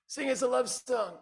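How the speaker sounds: lightly imitated old folks, high and slow; drawn-out mock plea from an audience; old folks' request. Old folks asking the singer for a tune, lightly imitated.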